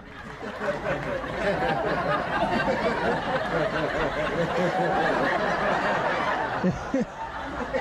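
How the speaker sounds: real laughter breaking out, rising high; long real laugh climbing in pitch; big rising laugh. Real, not acted. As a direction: crack up at your own punchline. Laughs at own story punchline.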